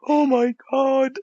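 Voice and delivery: mock a horrified prude, high and loud; scandalized prude. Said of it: "that'd be like".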